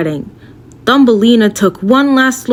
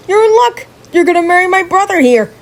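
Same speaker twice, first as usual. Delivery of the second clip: higher, pushy salesman frog; gloating frog; 7 semitones higher than usual. Acted. Frog pushing Thumbelina toward his brother, pushy and gloating. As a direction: sell the wedding like a bargain.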